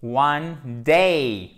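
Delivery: "One day" loud, "day" stretched into an ey glide; exaggerated diphthong. Shown as a demonstration. Teacher insisting on the diphthong, "One day" loud and elongated. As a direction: really draw out the glide in "day". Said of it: "Faut vraiment faire cette diphtongue".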